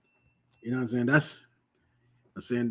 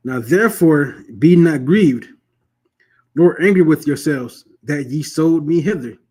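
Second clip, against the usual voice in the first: slow, louder, declaiming each word; solemn scripture reading; 3 semitones higher, 5 dB louder, slower than usual. Acted. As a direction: proclaim the verse slowly and loudly from the pulpit. Reads scripture aloud.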